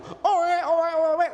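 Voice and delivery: high, loud imitated newborn wail; tangis bayi tiruan. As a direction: mimic a newborn's repeated crying. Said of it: "Keluar anak itu". (tiruan tangis bayi baru lahir)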